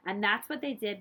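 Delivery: ordinary delivery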